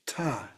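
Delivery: soft "ta" with a silent H; softened consonant. Shown as a demonstration. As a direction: let "ta" end openly on the vowel. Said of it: "silent H to soften the consonant".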